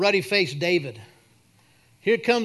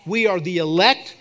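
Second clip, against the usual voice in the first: louder, rising proclamation in prayer; declarative prayer; 4 dB louder than usual. Real, not acted. Pastor declaring the church the elect, forceful prayer.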